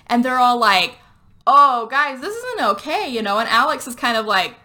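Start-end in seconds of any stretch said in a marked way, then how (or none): 1.4-3.1 high, exclaimed protest in retelling; mock group protest. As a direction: object in a raised, dramatic pitch. Acted group protest in retold film.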